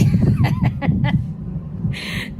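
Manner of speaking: a high-pitched, breathy laugh. Real, not acted. Reading a friend's joke in her DMs, laughing high. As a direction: let a real laugh burst out.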